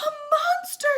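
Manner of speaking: high, loud scream of fright; terrified shout. Acted. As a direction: shriek it in terror. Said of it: "shouted Baby".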